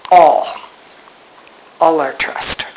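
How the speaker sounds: "All" alone first, firm and low; stress on ALL. Shown as a demonstration. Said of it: "the emphasis is on the word all".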